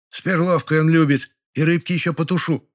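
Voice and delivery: high, chirpy woman's kitchen voice; cheerful housewife. A narrator's reading. Neighbour boasts of her cooking, cheerful. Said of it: "жизнерадостно прозвучал голос Ложкиной".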